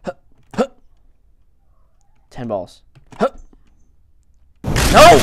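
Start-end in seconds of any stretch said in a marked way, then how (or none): 4.6-5.2 high, loud cry of defeat; loud outburst. Real, not acted. Gamer failing a throw, yelling loudly. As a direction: yell it out, then drop off. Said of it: "I'm sorry if I'm being loud there".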